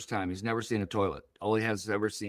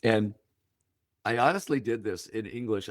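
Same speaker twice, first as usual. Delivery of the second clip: normal talking voice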